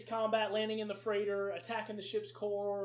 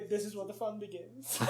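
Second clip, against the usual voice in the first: Anakin line; 5 dB quieter than usual. Retold or imitated. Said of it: "Like, if he".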